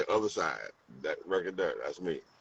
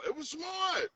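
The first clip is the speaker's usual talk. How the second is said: high admiring. Real, not acted. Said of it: "that was smart though".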